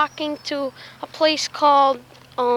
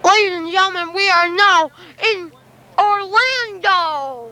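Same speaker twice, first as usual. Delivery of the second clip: child playing announcer, louder and higher; grand entrance announcement; 6 semitones higher, 5 dB louder than usual. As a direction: announce it like a tour guide. Kid announcing arrival.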